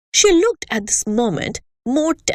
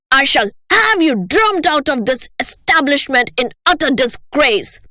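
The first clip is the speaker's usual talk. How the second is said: louder, higher raging tirade; shouting; 6 semitones higher, 4 dB louder than usual. Acted. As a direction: hurl each insult. Headmistress ranting at pupil.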